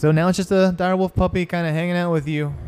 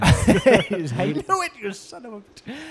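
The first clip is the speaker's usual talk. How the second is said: laughing mock curse; 8 semitones higher, slower than usual. Real, not acted. GM laughs at player.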